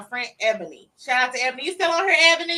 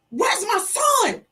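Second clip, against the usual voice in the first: high, drawn-out wail building louder; desperate scream; 13 semitones higher, 5 dB louder, slower than usual. Retold or imitated. Mother calls her son's friend to find her son, screaming. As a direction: demand an answer at a rising scream. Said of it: "oh, he's screaming and crying".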